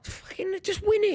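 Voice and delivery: high, strained plea to win; desperate urging. Real, not acted. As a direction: beg the team to finish it. Urging team, high pitched.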